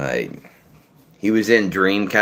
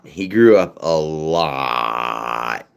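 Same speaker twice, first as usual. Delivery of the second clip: He grew up a lot stretched out very slowly, each word drawn long; slow, drawn-out repeat; 3 semitones lower, much slower than usual. Shown as a demonstration. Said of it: "repeated slower".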